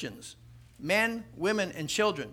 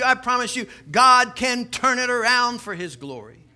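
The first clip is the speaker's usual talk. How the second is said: louder, higher, emphatic preaching; preaching at full force; 4 semitones higher, 5 dB louder than usual. Acted. Preacher promising congregation God turns things around.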